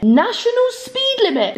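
National speed limit squeaked high in disbelief; a shocked, squeaky echo of the words; incredulous high repeat. Real, not acted.

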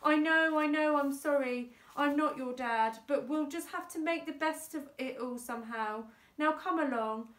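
consoling aunt. A narrator's reading. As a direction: console a crying child, firmly.

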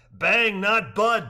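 slow, high, loud and deliberate; punning correction. A narrator's reading. Rafter correcting the guide, slow, loud joking stress. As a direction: lean on each word.

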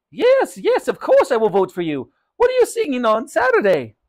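thrilled shopkeeper, very high and loud; Raj, excited. Acted. Shopkeeper promises mother his vote, excitedly. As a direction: gush it out at a leaping high pitch. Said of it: "said Raj excitedly".

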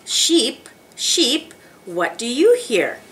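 ordinary talk, nothing marked

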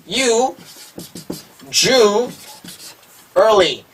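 U, Ju, early said as three separate chunks; usually in parts. Shown as a demonstration. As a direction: break usually into U, Ju, early with long pauses. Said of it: "두 번째 것은 우 소리". (U, Ju, early 세 부분으로 끊어서)

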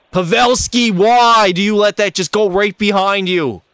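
high, loud exasperated yell at a teammate; frustrated yell. Real, not acted. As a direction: chew out a teammate for a bad play. Yelling at teammate's mistake.

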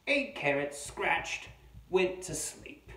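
loud, high, slow amateur Australian narration; attempted Australian accent. Reader voicing a carrot-demanding wombat for children, loud pretend Australian accent. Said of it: "not sure if this is a real Australian voice".

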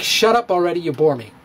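ordinary talk

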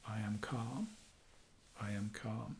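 hushed, very soft self-soothing mantra; calm affirmation. Shown as a demonstration. Coach shows anxious viewers what to repeat, hushed and calm. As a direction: murmur it softly to yourself, leaving long gaps. Models calming phrase for anxiety.

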